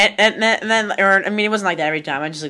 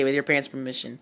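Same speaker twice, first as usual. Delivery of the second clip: commercial disclaimer voice; 5 semitones lower, 8 dB quieter than usual. Retold or imitated. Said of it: "the things that kids commercials always say".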